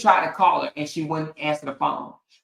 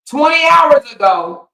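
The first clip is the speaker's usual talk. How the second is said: outraged shout; 6 semitones higher, 8 dB louder than usual. Acted. To the audience, yelling about his late post, outraged shout. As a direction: shout it high and loud.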